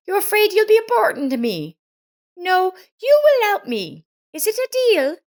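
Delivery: put-on high, bright-eyed concerned young woman's voice; kind neighbor Lisa. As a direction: play the caring neighbor, worried and eager to help. Lisa inviting Miranda to stay.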